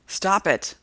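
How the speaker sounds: sharp shouted stop. A narrator's reading. Narrator shouting at the woman to let go. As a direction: yell at her to stop. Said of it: "I shout".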